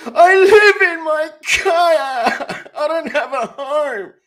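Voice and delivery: loud, high, drawn-out fake sobbing cry; mock wailing. Prankster to an electricity telemarketer, mock bawling. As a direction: wail it slowly at full volume, hammed-up. Repeats the confession as a wailing outburst.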